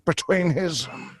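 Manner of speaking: high, halting voice with long gaps; Josh Widdicombe. Shown as a demonstration. Impressionist demonstrates comedian's pauses, high drawn-out impression. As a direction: break the phrase up, trailing each word slowly. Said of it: "he likes to take long pauses".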